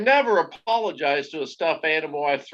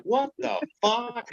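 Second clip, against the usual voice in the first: stunned disbelief; 7 semitones higher, 3 dB quieter, slower than usual. Retold or imitated. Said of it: "I'm like".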